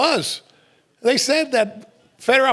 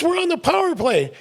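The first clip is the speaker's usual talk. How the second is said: higher mock protest; exasperated player; 10 semitones higher than usual. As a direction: remind the coach, fed up. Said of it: "He goes".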